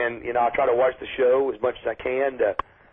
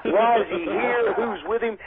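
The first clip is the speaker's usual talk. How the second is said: higher, urgent string of questions, slowing down; mock panicked executives; 6 semitones higher, slower than usual. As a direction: act out bosses scrambling over who's in the crowd. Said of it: "Vince McMahon wouldn't sell that".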